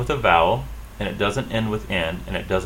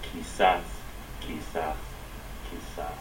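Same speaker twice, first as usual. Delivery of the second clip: quizás on the final -ZÁS, softer each time; last-syllable stress; 3 semitones lower, 9 dB quieter, slower than usual. Shown as a demonstration. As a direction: say qui-ZÁS, leaning on the end, fading with each repeat. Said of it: "stress the last syllable".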